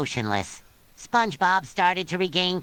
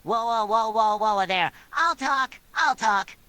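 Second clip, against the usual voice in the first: higher-pitched, mildly nervous surrender; nervous stutter; 5 semitones higher than usual. A narrator's reading. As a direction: repeat it with a touch of nerves. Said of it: "in a nervous voice he stuttered".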